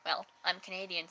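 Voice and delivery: normal talking voice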